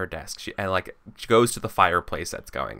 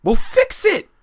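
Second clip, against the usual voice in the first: angry; 10 semitones higher, 7 dB louder than usual. Acted. Angry noblewoman demands he fix it.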